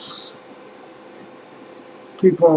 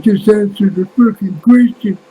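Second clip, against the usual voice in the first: higher, louder angry snap at him; mock furious demand, pitched way up; pissed-off demand; 8 semitones higher, 6 dB louder than usual. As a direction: imitate an irritated guy barking an order. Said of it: "He's getting pissed off".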